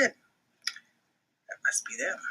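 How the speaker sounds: slow hushed whisper; secretive whisper aside. A narrator's reading. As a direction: lean in and whisper to the kids. Narrator aside to listeners.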